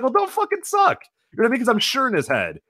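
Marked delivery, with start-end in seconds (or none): 0.0-1.0 mock angry retort. Imagined retort in wrestler's head.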